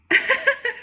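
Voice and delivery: high burst of laughter. Real, not acted. Speaker laughs at own story.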